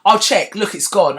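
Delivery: high, loud brushing-off husband voice; dismissive husband. Retold or imitated. Husband telling his wife the spider is gone, mock dismissive. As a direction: wave away her fear, hammed-up. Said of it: "And he's like".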